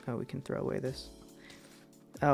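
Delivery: ordinary talk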